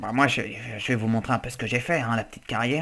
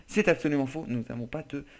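normal talking voice

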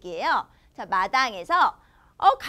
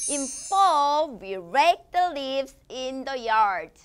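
very slow, word by word; slow model reading; much slower than usual. Shown as a demonstration. Teacher reading the sentence slowly for young learners. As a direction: read it out slowly for kids to repeat.

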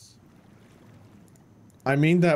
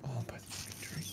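whispering; 2 semitones lower, 19 dB quieter, faster than usual. Real, not acted. Hiding from danger.

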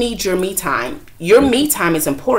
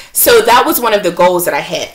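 ordinary delivery

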